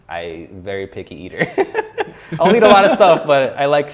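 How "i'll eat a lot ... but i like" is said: words bursting out through a real laugh, much louder and higher. Real, not acted. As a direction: laugh it out at yourself. Laughing about being picky eater.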